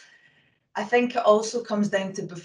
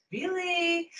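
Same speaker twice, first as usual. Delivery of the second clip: incredulous; 11 semitones higher, 7 dB quieter than usual. Retold or imitated. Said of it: "and you're like".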